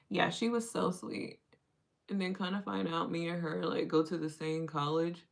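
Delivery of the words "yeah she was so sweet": ordinary talk, nothing marked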